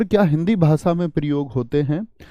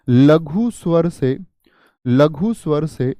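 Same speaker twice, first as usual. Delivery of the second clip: slow, measured repeat for note-taking; slow dictation; slower than usual. Shown as a demonstration. Teacher repeats the definition so students can write, slow dictation. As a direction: dictate it slowly, phrase by phrase.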